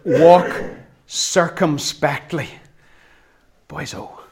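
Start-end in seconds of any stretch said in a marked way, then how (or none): 0.0-2.5 "walk circumspectly" drawn out slowly, "walk" stressed; savoring old word. Shown as a demonstration. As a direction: say "walk circumspectly" slowly, relishing each syllable. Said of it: "this wonderful old word".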